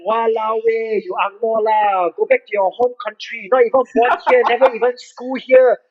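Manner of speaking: mock angry Singlish rant; imitating a rude local troll, high. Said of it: "they're like".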